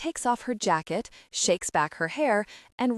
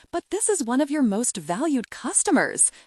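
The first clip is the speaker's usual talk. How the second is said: protest, voice lifting higher in dismay; rising dismay; 6 semitones higher than usual. A narrator's reading. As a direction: pitch climbing up in a dismayed plea. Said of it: "Suze's voice rises in dismay".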